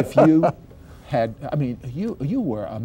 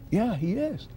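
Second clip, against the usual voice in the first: higher, softer matter-of-fact answer; retold mother's reply; 6 semitones higher, 5 dB quieter than usual. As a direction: lightly voice a mother quietly confirming it. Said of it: "And my mother said".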